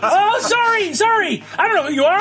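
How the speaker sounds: very high, anxious apology; flustered character voice. Acted. Game master as Demodus apologizing to a stranger, flustered and high. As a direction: squeak out a hurried apology.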